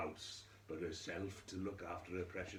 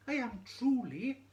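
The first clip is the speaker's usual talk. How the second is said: put-on very high, loud little child voice; shrill fairy child insisting; changeling child; 10 dB louder than usual. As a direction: squeak it out like a tiny insistent child. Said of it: "said the little one".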